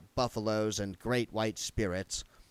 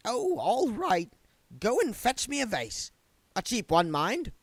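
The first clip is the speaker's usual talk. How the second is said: put-on higher, resigned old woman's voice; grudging housewife; 12 semitones higher than usual. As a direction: give in with a sigh.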